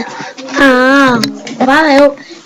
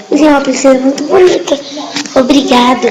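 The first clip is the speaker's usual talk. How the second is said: ordinary talk, nothing marked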